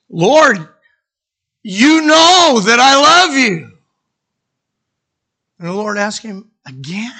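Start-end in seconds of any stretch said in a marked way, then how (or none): 0.1-3.7 high, loud, slow plea; insistent declaration. Acted. Peter answering the Lord's repeated question, loud pleading insistence. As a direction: press each word out loudly.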